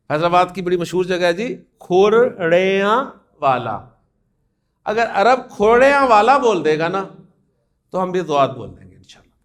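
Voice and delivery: normal talking voice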